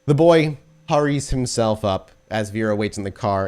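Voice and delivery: usual speaking voice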